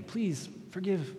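slow, high plea; mock begging. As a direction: beg with a lifted voice. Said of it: "he was begging him".

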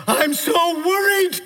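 high, drawn-out worried wail; hammed-up anxious cry, slow and high; mock worried. Preacher acting out worry for the congregation, mock worried. Quotes a worried feeling, acted out.

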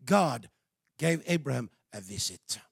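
ordinary talk, nothing marked

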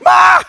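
mock high shriek like a little girl; inner scream. Pastor acting his inner panic at the snake, hammed-up high shriek. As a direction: let out a huge hammed-up girlish scream. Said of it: "am screaming like a little girl".

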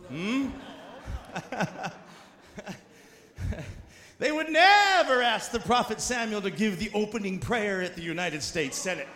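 ordinary delivery

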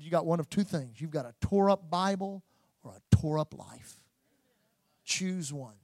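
low, soft, weighty delivery with pauses; quiet preaching challenge. Real, not acted. Preacher putting a choice to the church, quiet and solemn.

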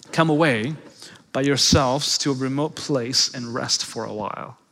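tender invitation. Acted. Pastor voices Jesus inviting rest.

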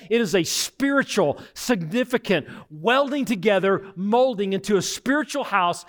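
lifting, emphatic sermon voice; preacher building to a climax; rising preaching. Acted. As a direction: preach it with growing fervour.

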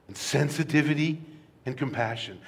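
ordinary delivery

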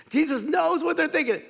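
loud, high-pitched preaching peak; emphatic preaching. Real, not acted. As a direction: drive the point home at full force.

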